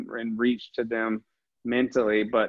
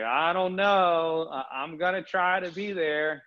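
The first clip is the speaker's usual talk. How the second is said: unsure; 5 semitones higher than usual. Retold or imitated. Host retelling teammate's early doubts about the trip, unsure. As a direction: imitate someone hesitantly hedging. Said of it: "like, a quarter way in, Liana was like".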